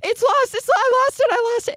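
put-on high, wailing child crying that it's lost; mock a kid melting down over a dropped toy; imitated kid panic. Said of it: "kids panicking in the car".

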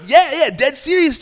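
high, excited insistence; eager confirmation. Retold or imitated. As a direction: re-enact an eager informant. Retold man eagerly confirms his story.

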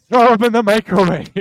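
loud, high hype; energetic shout. Real, not acted. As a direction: belt it like an ad. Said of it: "Tansy level energies".

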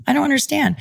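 high, confused self-quote. Retold or imitated. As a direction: sound lost and thrown by the news. Retold confusion over name.

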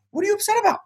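high, quick pep talk to yourself; cheerful inner voice. Retold or imitated. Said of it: "I was like".

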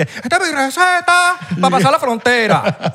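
high, laughing put-on shout of the catchphrase; laughing mock shout. Host imitating a stranger's yell, laughing mock shout. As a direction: yell it like a tourist crossing the border, cracking up. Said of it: "Y el que".

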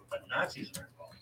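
ordinary talk

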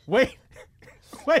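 high, loud laughing "wait"; cracking up while trying to go on. Real, not acted. Storyteller to her co-host, laughing loudly.

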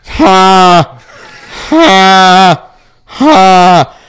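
loud, strained panting bursts from a labouring cat; hammed-up cat panting. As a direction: pant it out like the cat giving birth.

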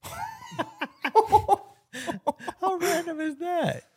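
high laughing voice. Real, not acted. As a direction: laugh through the words. Laughing after the story.

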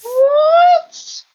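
can't believe what she just heard; shocked what. Real, not acted. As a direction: listener stunned by father's favoritism, loud high shock. Shocked by dad's favoritism post.

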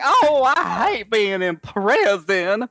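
high, languid whining character voice; exaggerated, drawn-out crime boss lament; Zero the Hutt. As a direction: complain slowly in a high put-on drawl. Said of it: "do a good Zero impression".

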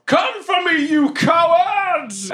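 huge high-pitched challenge, last word stretched; drawn-out battle shout; defiant yell. Acted. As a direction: yell it up at the sky. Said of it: "and I yell".

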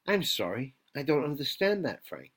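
polite computer assistant; HAL-like computer voice. Acted. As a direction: apologise like a talking computer. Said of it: "sounds kinda like the computer".

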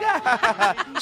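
high, fake canned laugh; high mock studio-audience laugh; imitated audience laughter. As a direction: mimic a sitcom laugh track. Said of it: "the audience is like".

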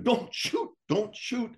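high, panicked yelling of a warning; screaming. Retold or imitated. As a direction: scream it across the field to stop him. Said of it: "they're all screaming".

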